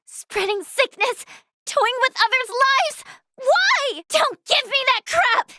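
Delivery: high-pitched, indignant accusation; appalled, pleading anger pitched high; outraged confrontation. Acted. Healer confronts the one spreading sickness, outraged.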